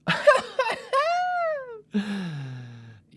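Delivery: shrill burst of laughter falling off; high cackling laugh. Real, not acted. Host cracking up at his own bit, high cackle.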